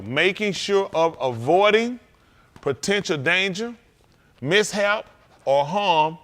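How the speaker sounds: slow, deliberate phrases with pauses between; reading a definition. Real, not acted. Preacher defining careful for young people, slow deliberate reading.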